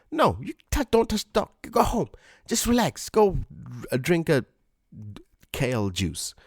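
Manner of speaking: exasperated order to go home and relax; exasperated scolding. Acted. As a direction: shoo the pushy stranger away, exasperated. Telling off the entitled stranger.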